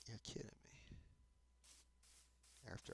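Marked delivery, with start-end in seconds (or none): 0.1-1.0 much softer, exasperated whisper; whispered exasperation. Real, not acted. Muttering to himself about the long walk back, in a much softer exasperated whisper. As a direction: breathe it out, fed up.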